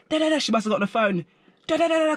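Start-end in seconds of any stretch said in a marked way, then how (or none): 1.7-2.2 high nonsense jabber imitating her screaming; pitched-up mock ranting. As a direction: mimic a distant rant in da-da syllables. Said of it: "All I hear is screaming from her".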